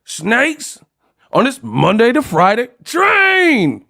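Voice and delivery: high-pitched mock dub of a TV edit; Samuel Jackson. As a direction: drag out the silly censored swear words, high. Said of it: "So he was like".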